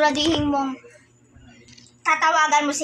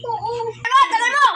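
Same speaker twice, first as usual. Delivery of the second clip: high, loud outburst; raised impatient yell; impatient complaint; 8 semitones higher, 3 dB louder than usual. Acted. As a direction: call out fed up with waiting. Impatient for the phone.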